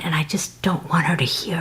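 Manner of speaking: hushed unvoiced whisper, keeping it secret; whispering into the phone so her daughter won't hear. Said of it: "why are you whispering".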